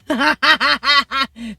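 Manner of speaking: bursting laugh; loud laugh. Real, not acted. Laughing at her own Colombia joke, loud laugh.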